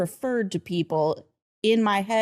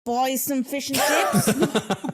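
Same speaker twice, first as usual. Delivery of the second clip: put-on a theatre-kid Cockney accent, slowing on the chips; 4 semitones higher than usual. Host showing the Cockney accent she learned as a theatre kid. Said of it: "first accent I could really, like, do".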